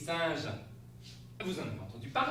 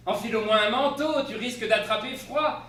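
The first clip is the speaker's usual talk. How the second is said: with a touch of humour; 6 semitones higher, 7 dB louder than usual. Acted. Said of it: "avec une pointe d'humour".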